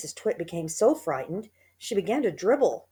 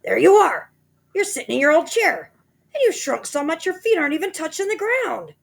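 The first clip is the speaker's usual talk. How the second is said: much louder, much higher triumphant shout; 11 semitones higher, 8 dB louder than usual. A narrator's reading. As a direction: crow at her in triumph.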